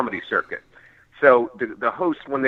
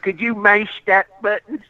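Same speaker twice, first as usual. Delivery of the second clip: Southern lady; 9 semitones higher than usual. Retold or imitated. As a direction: ask it as a drawling Southern woman. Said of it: "this lady was like".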